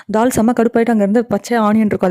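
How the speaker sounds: ordinary talk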